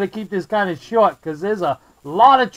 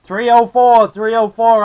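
loud radio call; 3 semitones higher, 7 dB louder than usual. Real, not acted. Calling out over CB radio.

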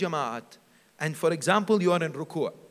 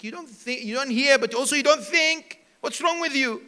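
mock scolding, higher and louder; retold scolding; 6 semitones higher, 7 dB louder than usual. Preacher quoting a man's rebuke, retold scolding. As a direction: tell someone off in a raised voice. Retelling a scolding of someone hard of hearing.

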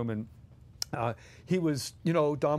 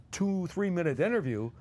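ordinary talk, nothing marked

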